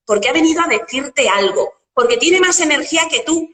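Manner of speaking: ordinary talk, nothing marked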